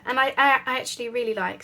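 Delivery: stumbling over the words; stuttering. Real, not acted. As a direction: stutter on the start of the sentence. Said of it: "sorry, I'm stuttering on my words".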